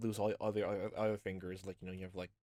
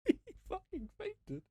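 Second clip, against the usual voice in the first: squeaky high voice; slower than usual. Acted. Mocking squeaky voice.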